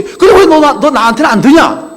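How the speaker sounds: retold angry outburst from an offended friend. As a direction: let the hurt friend bark his grievance. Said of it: "화가 잔뜩 나 찾아왔어요".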